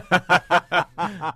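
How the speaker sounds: a loud burst of laughter. Real, not acted. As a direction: crack up mid-ad.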